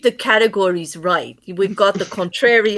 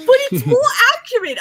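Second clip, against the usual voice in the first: high, fast giggly insistence; laughing protest; 10 semitones higher, faster than usual. Real, not acted. Joker defending her term to a pained friend, laughing. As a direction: insist through real laughter, pitch jumping up.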